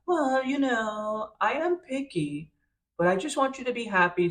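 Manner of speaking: a mother politely holding back disapproval; picky mom voice. Retold or imitated. Iris's mother judging Pepe.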